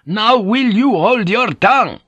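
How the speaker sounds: slow, high, loud command to be silent; stern rebuke. A narrator's reading. Superior priest silencing an arguing Jesuit, sternly. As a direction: silence him with mounting outrage.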